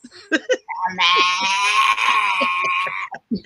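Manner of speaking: loud wheezing laugh, almost choking. Real, not acted. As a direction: laugh until you nearly choke. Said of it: "You sound like about to choke".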